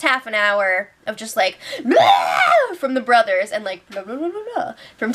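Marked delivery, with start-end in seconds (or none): 1.8-2.7 loud, high "Blah!" shouted over everyone; mock yell. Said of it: "the brothers are just yelling". 3.9-4.7 calm murmur. Retold or imitated. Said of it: "Tim and Cass are calm".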